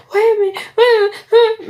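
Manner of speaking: high, fast, loud panicked whining; rushed squeaky mock despair; frantic panic. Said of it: "and I'm like".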